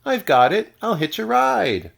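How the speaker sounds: high, loud eureka cry; excited cry. A narrator's reading. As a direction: shout out the bright idea. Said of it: "then cried".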